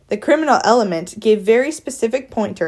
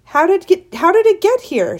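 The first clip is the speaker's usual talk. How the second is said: high-pitched shouted question; alarmed shout; 10 semitones higher, 4 dB louder than usual. A narrator's reading. Mother spotting the squirrel in her living room, shouting. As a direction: shout it up high, aghast at the intruder. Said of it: "shouted Flora's mother".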